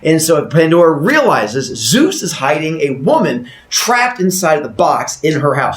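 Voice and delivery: plain reading voice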